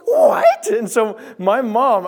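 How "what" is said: high shrieking "What?" in mock panic; alarmed shout. Son imitates snake-hating mom hearing about the snake, shrieking. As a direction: imitate mom's horrified yell, pitch way up.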